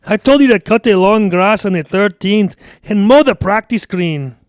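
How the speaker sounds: loud, high Scottish bellow; angry Scottish accent. Acted. Boss scolding his groundskeeper Carl, angry Scottish shouting. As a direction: tear into him as an irate Scotsman. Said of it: "And he's, and he's angry".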